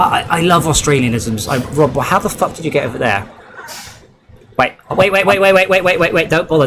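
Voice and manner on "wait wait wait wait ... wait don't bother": rapid-fire repeated words, pitched up then settling; urgent interrupting. Real, not acted. Streamer telling a fellow player not to bother, urgent rapid repetition. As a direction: rattle it off to stop someone. Urgently stopping Rob from driving over.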